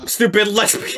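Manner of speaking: normal talking voice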